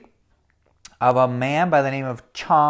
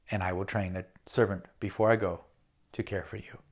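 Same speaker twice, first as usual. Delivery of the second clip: softer, lower, calm explanation; quiet solemn servant; 8 semitones lower, 7 dB quieter than usual. Acted. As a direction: speak simply and gravely. Chong explains his sacrifice.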